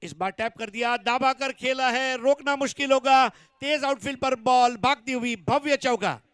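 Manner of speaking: loud, rising boundary call; excited cricket commentary. Real, not acted. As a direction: shout the four as it races away.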